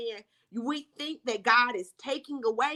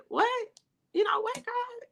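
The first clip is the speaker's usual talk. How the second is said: sudden high-pitched what?; shocked disbelief; 13 semitones higher than usual. Retold or imitated. Retelling her stunned reply to God, much higher. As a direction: caught off guard, voice jumps up.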